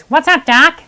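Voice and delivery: light Bugs Bunny quip; teacher demos a cartoon catchphrase, lightly voiced. Shown as a demonstration. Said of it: "Bugs Bunny goes".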